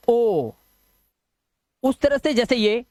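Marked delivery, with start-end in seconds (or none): 0.1-0.5 ओ held as a pure "oh"; long O sound. Shown as a demonstration. Teacher isolating the sound of OW, ओ as long "oh". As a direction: sustain a rounded "oh" for ओ. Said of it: "ओ की मात्रा वाला".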